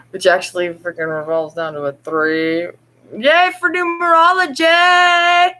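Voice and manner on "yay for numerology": high, slow hammed-up cheer; mock cheer. Speaker joking about numerology, mock excited cheer. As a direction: cheer it like a silly party.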